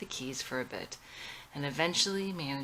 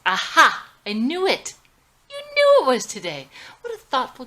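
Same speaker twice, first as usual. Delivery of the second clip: high, loud gotcha cry; triumphant outburst; 9 semitones higher, 13 dB louder than usual. Acted. Madison catching her husband in a lie, loud and triumphant. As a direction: pounce on him, caught you.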